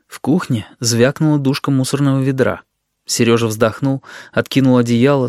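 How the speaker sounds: usual speaking voice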